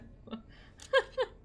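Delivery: short, high-pitched burst of giggling; high laugh. Real, not acted. Streamer laughing at their own muddle, high giggle.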